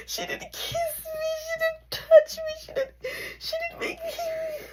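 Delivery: high, hammed-up wail; mock crying. Mock sobbing because she gave no hug. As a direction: sob it out like a fake breakdown.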